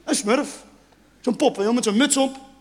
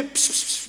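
short soft voiceless pss-pss; quiet sibilant beckoning; cat-calling hiss; 8 dB quieter than usual. Shown as a demonstration. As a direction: call the cat with whispered sibilants. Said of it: "Als je een kat wil roepen, zeg je".

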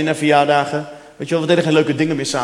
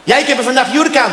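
high, louder broken-Dutch cleaner voice; flirty cleaner; 8 semitones higher, 4 dB louder than usual. Retold or imitated. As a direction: play the flirty foreign cleaner, pitched up. (hoge, luidere stem van de flirtende schoonmaakster)

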